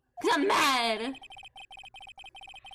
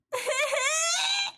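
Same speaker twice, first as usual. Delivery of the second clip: high shrieking laughter; helpless cackling shriek; 13 semitones higher than usual. Real, not acted. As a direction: laugh yourself breathless, very high. Laughing hysterically.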